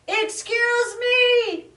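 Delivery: high, slow, loud call; shouting. Acted. Possum shouts to muskrat over the peeping. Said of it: "shouted Possum".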